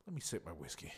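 soft whispered aside before a sip. Real, not acted. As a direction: murmur it as you reach for the glass. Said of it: "I'm drunk".

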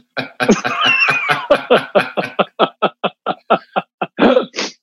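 a real high laugh rolling on; long laugh. Real, not acted. Recalling Marky's scary first rehearsal, a long real laugh. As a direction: laugh it out, rising as it goes.

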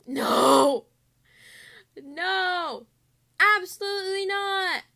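protesting loudly about group work; outraged shout. Real, not acted. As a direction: yell no like it's a disaster. Learns it's group work.

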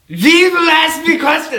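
a hammed-up high, slow attempt at a German accent; bad German accent. Asked to play Freud, a bad German accent. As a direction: fake a German accent badly and loudly. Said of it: "I can't do a German accent".

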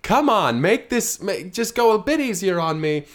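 high, loud, slow whine; whiny plea. Acted. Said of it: "he's like".

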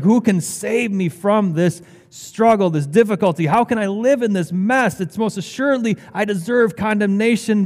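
impassioned, exasperated self-questioning; frustrated struggle. Acted. Preacher voicing Paul's struggle with sin, frustrated. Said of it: "it frustrated him. He's like".

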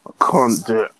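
normal talking voice

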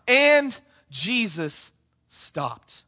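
drawn-out, very slow words; preacher slowing right down for effect; slow dramatic pause. Shown as a demonstration. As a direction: stretch it, let each word land. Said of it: "Let that sink in for a second".

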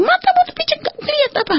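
very high, harsh yell; shouted warning. Retold or imitated. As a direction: scream it at someone about to fall. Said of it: "langsung teriak". (teriakan tinggi dan kasar)

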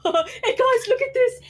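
squeaky high excited call; excited high squeal. Acted. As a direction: shout it out like a thrilled kid. Player voices character excitedly.

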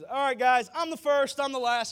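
God bursting into the room, shouting who he is; booming God voice. Retold or imitated. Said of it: "When just God walks in, he's".

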